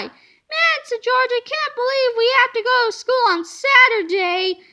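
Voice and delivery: put-on high, whiny boy voice; a boy grumbling about Saturday school; kid complaining. A narrator's reading. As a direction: whine like a bored kid.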